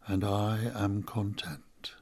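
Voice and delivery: whispered contentment. Acted. Peaceful close of story.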